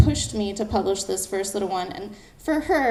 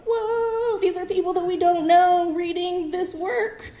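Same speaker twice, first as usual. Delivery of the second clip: long, high-pitched "whoa" of awe; amazed wonder; 8 semitones higher, slower than usual. Retold or imitated. Two friends watching views tick up, amazed wonder. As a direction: marvel at it, voice held high. Retelling amazement at strangers reading.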